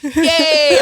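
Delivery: high, laughing mock cheer; celebrating cheer. Retelling election celebrations to friends, high mock cheer. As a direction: cheer like a party just broke out. Acting out the celebration after the win.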